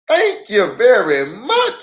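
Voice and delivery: Papa thanking the mechanic; relieved thanks. Acted.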